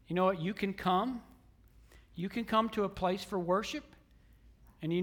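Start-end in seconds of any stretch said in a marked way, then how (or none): none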